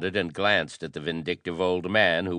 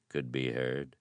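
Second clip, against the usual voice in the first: usual speaking voice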